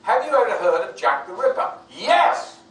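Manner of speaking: loud, high question capped by a shouted yes; exasperated mock questionnaire. Actor mocking studio questionnaires, loudly exasperated. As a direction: hammer out the question, then explode on the answer. Mocks questionnaire, answers it emphatically.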